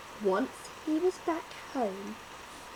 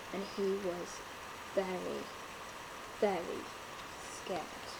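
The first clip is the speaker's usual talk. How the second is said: soft, lower, whispered at the end; hushed fear; 5 semitones lower, 10 dB quieter than usual. A narrator's reading. Narrator whispers how scared the lion was. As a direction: shrink the voice down with fear.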